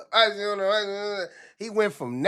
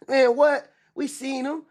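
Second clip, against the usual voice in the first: higher, excited gossip; gossiping inmates; 7 semitones higher than usual. Retold or imitated. Inmates gossiping about a rich rapper, excited and higher. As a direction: hype up the rumor like jail gossip. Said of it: "they like".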